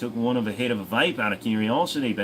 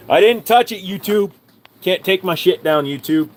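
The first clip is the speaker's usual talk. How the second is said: much higher and louder, indignant yell; angry protest; 8 semitones higher, 8 dB louder than usual. Real, not acted. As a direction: shout your innocence at YouTube. Protesting YouTube ban threat.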